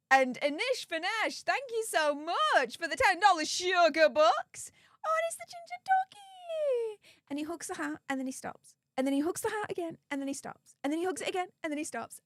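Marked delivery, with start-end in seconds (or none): 5.0-7.0 very high, squealy coo. Real, not acted. Cooing over a cute dog.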